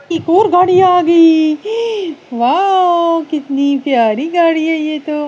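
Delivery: high, loud, slow squeal of delight over a new toy car. Real, not acted.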